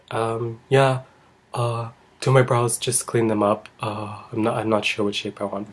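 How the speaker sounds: soft, hesitant mumble of an unsure client; timid and halting; quiet, nervous client. Retold or imitated. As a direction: imitate a shy client, trailing softly with pauses. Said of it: "those quiet and nervous clients".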